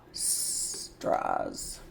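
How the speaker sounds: "S-, draws" breathed with the S stretched; whispered sounding out. Shown as a demonstration. Teacher blending the S onto draw, whispering. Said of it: "Put an S sound on the end".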